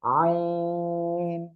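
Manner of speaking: আইন pressed from mid-throat, "i" held long and level. Shown as a demonstration. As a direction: intone আইন very slowly. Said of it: "গলার মধ্যখান থেকে".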